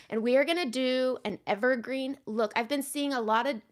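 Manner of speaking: ordinary delivery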